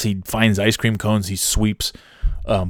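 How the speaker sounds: ordinary delivery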